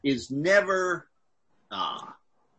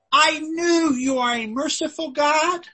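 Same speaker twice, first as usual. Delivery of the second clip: high, accusing protest to God; angry Jonah; 10 semitones higher, 3 dB louder than usual. Retold or imitated. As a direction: complain upward like an angry prophet. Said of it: "He is angry".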